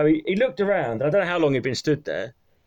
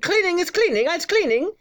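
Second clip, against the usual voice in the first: put-on very high Eastern European accent; Eastern European woman; 14 semitones higher than usual. Cleaner refusing a man entry, high imitated Eastern European accent. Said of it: "I can do her voice".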